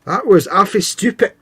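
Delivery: high, put-on Glaswegian scolding; Glaswegian accent. Host teases clumsy friend, mock Glaswegian accent. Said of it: "I love the way Glaswegians say stupid".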